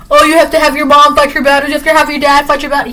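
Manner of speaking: high, fast jeering mimicry; mock indignation. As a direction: taunt in a high, rushed, mad voice. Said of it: "like, getting mad".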